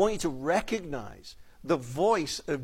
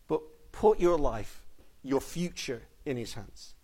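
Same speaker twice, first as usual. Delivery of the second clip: slow earnest plea; 3 semitones lower than usual. Real, not acted. Preacher urges trust, slowing.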